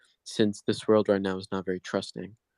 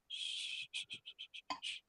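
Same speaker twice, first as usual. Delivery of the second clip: soothing shushing; 19 dB quieter than usual. Acted. Soothing a raging companion.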